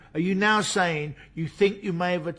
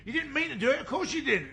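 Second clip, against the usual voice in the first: pressing hard; 9 semitones higher than usual. Acted. Detective pressing suspect, high pitched.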